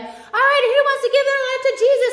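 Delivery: high, loud, fast rallying call; hyped-up youth leader. Retold or imitated. Youth leader to jazzed-up camp kids, hyped rallying shout. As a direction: whip the crowd up like a camp emcee. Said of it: "they get the kids all jazzed up".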